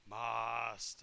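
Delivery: low, creepy zombie-head murmur; creepy undead whisper-voice. Acted. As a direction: breathe the word like a servile dead thing. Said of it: "you hear it creepily say".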